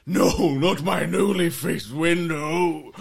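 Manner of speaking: high, very loud, drawn-out wail; slow, hammed-up howl of dismay; acted anguished shout. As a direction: cry out over your smashed window. GM voices owner as window smashes.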